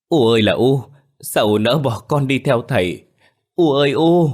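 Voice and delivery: loud, high acted wail; screaming in grief. A narrator's reading. Soan clutches her mother's body, wailing loudly in grief. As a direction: cry out to a dead mother, rising at the end. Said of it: "miệng gào thét".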